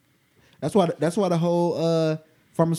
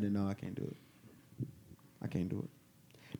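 quiet; 8 semitones lower, 17 dB quieter, slower than usual. Real, not acted. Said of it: "quiet refusal repeated".

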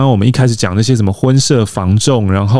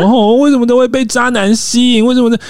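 higher, whiny imitation of a girl baffled by bad boys; mock lovesick girl; 12 semitones higher than usual. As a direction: sigh it like a girl who always falls for jerks. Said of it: "就为什么有女生会一直觉得说".

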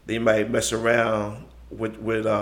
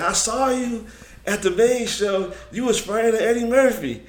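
high-pitched imitation of an excited girlfriend; put-on high girl's voice, gushing; 10 semitones higher than usual. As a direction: mimic her in a much higher voice. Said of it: "girl I was talking to".

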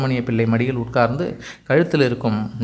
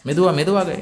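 raised, higher call of caution; 6 semitones higher, 4 dB louder than usual. A narrator's reading. Father calling after his running little girl, raised caution.

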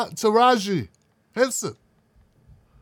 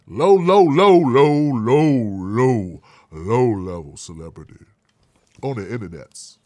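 voice sinking low; 10 semitones lower, slower than usual. Pretend. Vlogger mocking his own status, dropping pitch. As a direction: pitch drops lower and lower with each low. Descending low level joke.

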